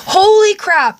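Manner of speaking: high, loud holy crap; shocked exclaim. Real, not acted. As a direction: burst out in shock. Shocked at the snow.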